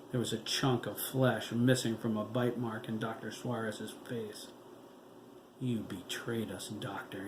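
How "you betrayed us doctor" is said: low, soft menacing growl. A narrator's reading. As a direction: growl the accusation quietly. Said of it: "he growled".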